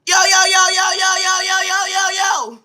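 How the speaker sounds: loud, high repeated yo shout from far off; owner calling out. Retold or imitated. Owner shouting yo at him from two blocks away. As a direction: bellow yo over and over. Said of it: "All you hear is".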